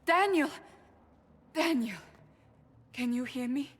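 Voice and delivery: high, loud shout of a name; urgent calling out. Acted. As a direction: call out to a friend you have just found. Calls out to found friend.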